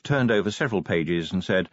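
ordinary delivery, nothing marked

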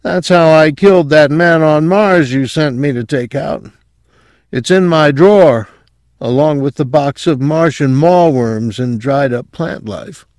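high, lightly dazed patient voice; drugged mumble. A narrator's reading. Drugged patient confesses a killing, lightly dazed and high. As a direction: confess with a hint of sedation, pitched high. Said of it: "Quayle mumbled".

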